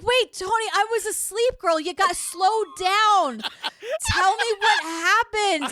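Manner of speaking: a drowsy man begging for an explanation; lightly acted bewildered DJ; mock sleepy radio host. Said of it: "And he's like".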